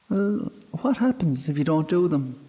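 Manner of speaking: put-on a high, uneasy young girl; girl worried by the fairy's words. A narrator's reading. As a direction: ask with sudden guilt. Guilty girl asks.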